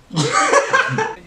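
high, loud laughing burst; sudden real laugh; burst of laughter. Real, not acted. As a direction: laugh out at himself. Caught not paying attention.

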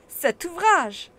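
high, loud and slow exclamation; eager old professor; excited uncle. A narrator's reading. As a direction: burst out eagerly like a thrilled scholar.

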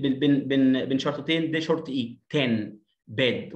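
usual speaking voice

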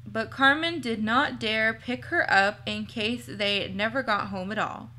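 ordinary delivery, nothing marked

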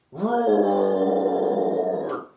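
deep, very loud T-Rex roar; dinosaur roar. Acted. T-Rex roaring down in the valley, deep and loud. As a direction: roar like a huge tyrannosaurus.

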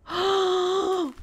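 high, loud gasp; sharp intake of excited surprise; thrilled gasp. Real, not acted. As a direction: gasp in amazed delight. Seeing a distorted skull.

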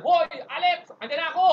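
loud playful shout from the rain; rain calling out. Pretend. Rain shouting at Alex from the corner, loud mock call. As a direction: yell it like the rain chasing Alex. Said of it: "sabi ng ulan".

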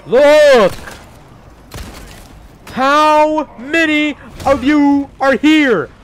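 high, loud and slow, drawn out in disbelief; exasperated yell. Real, not acted. Player yells at endless enemies in disbelief, drawn-out shout. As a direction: bellow it at the endless horde. Enemies keep coming in game.

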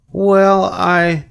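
put-on high, hesitant child voice; thoughtful. A narrator's reading. Fern wonders how to describe Charlotte, thoughtful child. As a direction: draw it out as if working it out. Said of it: "said Fern thoughtfully".

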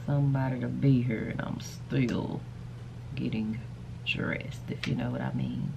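slurred, drowsy speech; anesthesia groggy. Real, not acted. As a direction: talk like you're drugged up. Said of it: "Sorry, I'm talking like I'm drugged up".

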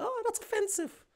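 high, prissy mock outrage; mock offended stranger. Mocking a stranger who sees his jumper, high and outraged. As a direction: squeal it like a pearl-clutcher. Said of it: "be like".

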